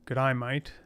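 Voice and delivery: light Australian accent, "mate" said like "mite"; a playful hint of Australian twang. Shown as a demonstration. Showing the viewer how to speak Australian, light Australian accent. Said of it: "That's how you speak Australian".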